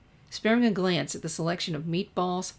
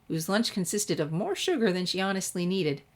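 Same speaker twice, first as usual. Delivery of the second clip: usual speaking voice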